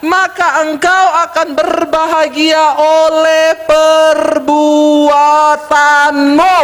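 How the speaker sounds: slow, high, building to loud drawn-out syllables; scripture proclamation. Real, not acted. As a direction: proclaim the verse, stretching the last word hugely. Preacher quotes scripture for the congregation.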